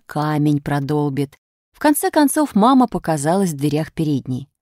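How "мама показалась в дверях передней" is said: ordinary delivery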